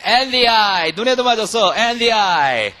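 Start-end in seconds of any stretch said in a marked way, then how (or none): 0.0-0.9 loud, slow drill of the line; repeat-after-me drill. Shown as a demonstration. Teacher drilling the class on the clip line, loud and slow. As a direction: say it clearly for students to repeat. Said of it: "뭐래?".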